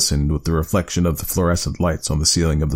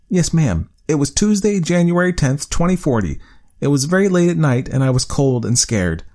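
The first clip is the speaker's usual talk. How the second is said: put-on higher child voice, precise and dutiful; a hint of a careful boy reciting a date; earnest young boy; 9 semitones higher than usual. Child recalls first day.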